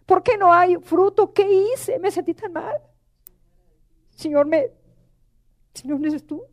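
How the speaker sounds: oración afligida, más aguda, casi quebrándose; llanto recordado. Retold or imitated. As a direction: suplica al Señor con la voz rota. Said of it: "me solté llorando". (rezo entre lágrimas, tono alto)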